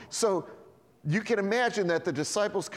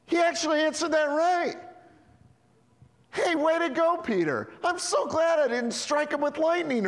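Gabriel; 9 semitones higher than usual. Acted. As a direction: cheer like a relieved angel. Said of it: "I can just imagine Gabriel going".

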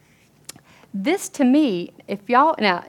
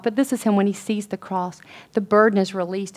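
usual speaking voice

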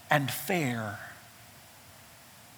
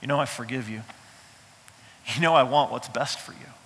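softer and lower, gentle reassurance; God gently reassuring David; tender God voice; 6 semitones lower, 4 dB quieter, faster than usual. Retold or imitated. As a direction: speak as a loving father reassuring. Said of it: "He says".

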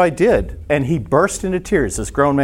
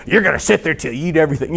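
a quick, lightly voiced stern father; stern dad voice; 4 semitones higher, much faster than usual. Pastor imitates his father scolding at the dinner table, quick stern dad voice. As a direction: rattle it off like a strict dad laying down the law. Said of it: "it's like the voice of my dad".

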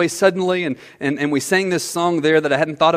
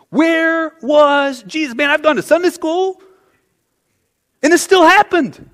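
anguished lament; 10 semitones higher than usual. Retold or imitated. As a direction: plead it up at heaven. Voicing the grieving believer's lament.

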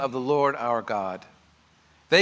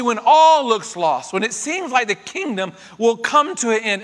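rising, louder sermon build; preaching at full force; 5 semitones higher, 6 dB louder than usual. Acted. Preacher to his church, building to a loud climax. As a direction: press it home to the congregation.

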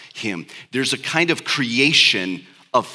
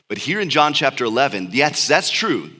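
ordinary talk, nothing marked

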